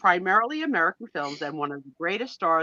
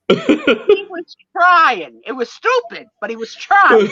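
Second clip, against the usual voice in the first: laughing defense; 9 semitones higher, 12 dB louder, slower than usual. Real, not acted. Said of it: "defending the guy".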